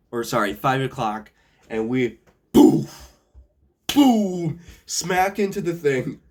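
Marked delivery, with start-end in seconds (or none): 2.5-4.6 loud "boom" crash noises; imitated impact. Retelling the car smacking into the thing, loud imitated impact. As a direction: punch out each boom like a collision.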